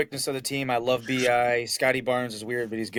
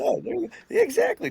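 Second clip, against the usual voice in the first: voice crack; faster than usual. Real, not acted. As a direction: voice cracking up high. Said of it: "Nice voice crack again".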